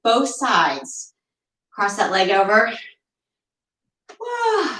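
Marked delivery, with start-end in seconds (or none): 4.2-4.8 surprised whoa. Real, not acted. Stretch surprises the instructor.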